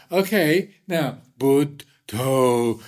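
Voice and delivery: hammed-up teasing master, stretching the word out; coaxing old teacher. Teacher pressing stick on chest.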